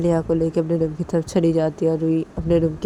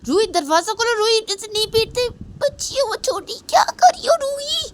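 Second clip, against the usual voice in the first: much higher shrill-pitched anxious calling; grandmother calling; 6 dB louder than usual. Acted. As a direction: call through the door in a panic. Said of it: "knock करते करते रूही को बोलती". (घबराई दादी की ऊँची पुकार)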